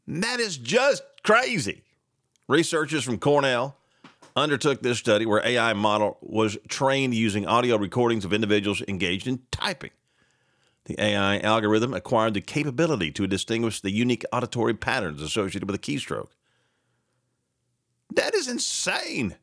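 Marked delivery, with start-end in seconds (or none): 0.1-1.8 high, slow, stunned; high astonished exclamation; amazed. Real, not acted. Reacting to AI news story. 18.1-19.4 very high, slow disbelief; high astonished exclamation; amazed. Real, not acted. Reacting to AI news story.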